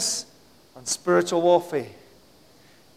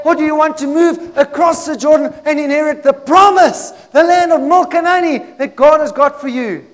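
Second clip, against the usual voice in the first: loud, raised-pitch preacher's delivery; urgent, rousing sermon voice; full-force preaching; 6 semitones higher, 8 dB louder than usual. Real, not acted. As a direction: preach it out to the whole church.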